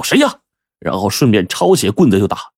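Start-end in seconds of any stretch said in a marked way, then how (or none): 0.0-0.4 sharp high call into the dark; shouted challenge. A narrator's reading. Brothers shouting at an intruder, sharply high. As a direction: yell out at the chicken thief. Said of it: "哥俩就喝道".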